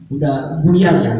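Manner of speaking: usual speaking voice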